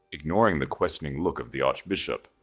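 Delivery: usual speaking voice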